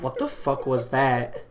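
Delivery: slow, stunned disbelief with a laugh in it; baffled. Retold or imitated. Staff member stunned after a caller's insult, slow and laughing. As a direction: drag it out, still in shock.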